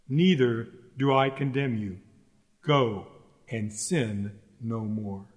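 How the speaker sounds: low, soft voice of Jesus pardoning; gentle absolution. Acted. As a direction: release them quietly, slowing toward the end. Preacher voices Jesus forgiving the adulteress.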